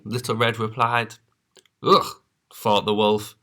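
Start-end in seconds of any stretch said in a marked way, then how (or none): 1.8-2.2 high loud grunt of disgust; disgusted ugh. Acted. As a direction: scoff at the fruit. Wolf disgust.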